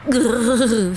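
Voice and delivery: a short growl from the grumpy dog. A narrator's reading. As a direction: growl like a cold, unhappy dog. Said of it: "fait Bouboule".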